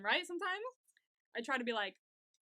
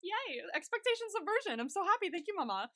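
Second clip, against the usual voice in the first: playful much higher cheer; imitating a delighted fan squeal, much higher; retelling own happy reaction, much higher; mock cheering; 9 semitones higher than usual. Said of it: "And I was like".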